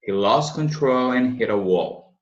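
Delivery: He lost control and hit a wall, slowly linked; slow fluent model. Shown as a demonstration. Teacher modelling the full sentence for students. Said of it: "na pronúncia mais fluida vai ser assim".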